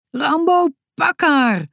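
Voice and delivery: high, urgent call; sharp, high-pitched shout; shouted command. A narrator's reading. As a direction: yell an order to the dog. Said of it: "schreeuwde Sisi".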